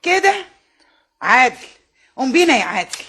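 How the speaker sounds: a mother who has had enough snaps at her son; offended outburst. Acted. (بانفعال وغضب، بصوت أعلى)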